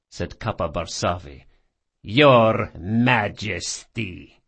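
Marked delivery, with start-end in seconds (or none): 2.0-4.4 slow, drawn-out sneer. A narrator's reading. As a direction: sneer the title with contempt. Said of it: "The last word was sneered".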